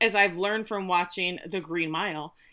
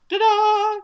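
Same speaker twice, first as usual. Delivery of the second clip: high, loud magician's flourish; showy reveal; 13 semitones higher, 8 dB louder than usual. Acted. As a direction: belt it out like a proud showman. Acting out the governor's grand reveal.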